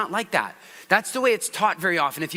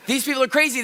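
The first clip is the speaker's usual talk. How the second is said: ordinary delivery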